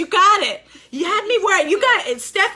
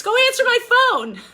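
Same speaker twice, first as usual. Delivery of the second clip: mock bossy, high and louder; playful high command; 9 semitones higher, 4 dB louder, faster than usual. Teacher teasing a student over her ringing phone, playful bossy. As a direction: tease the student with a jokey order.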